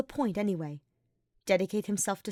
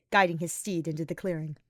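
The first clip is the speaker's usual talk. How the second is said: plain reading voice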